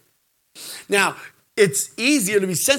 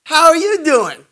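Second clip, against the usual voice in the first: higher, louder sweet woman's voice; the stranger texting back, coy and higher; flirty woman; 10 semitones higher, 8 dB louder than usual. Retold or imitated. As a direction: play her coy and sugary. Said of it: "And then she goes".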